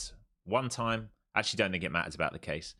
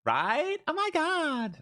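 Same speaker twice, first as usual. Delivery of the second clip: very high, loud mock valley girl squeal; valley girl impression; 13 semitones higher, 3 dB louder than usual. Streamer defending his impression to chat, high valley girl squeal. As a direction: shriek it like an over-the-top LA valley girl. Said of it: "That's a Valley girl all day long".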